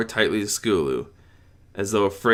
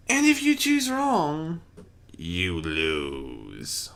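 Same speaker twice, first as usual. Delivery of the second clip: slow, teasing trickster threat; Discord voice; 4 semitones higher, much slower than usual. A narrator's reading. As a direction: drop to a sly warning.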